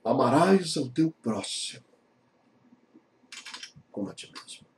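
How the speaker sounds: soft, fading reading of amarás ao teu próximo; whispered scripture ending; hushed reverent quote. Acted. Jesus' words quoted softly.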